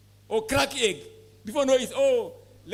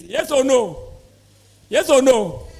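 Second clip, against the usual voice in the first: loud, rising demand for an answer; loud call to audience; 9 dB louder, slower than usual. Real, not acted. Lecturer calling out to farmers, loud and insistent. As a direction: push the room to answer you.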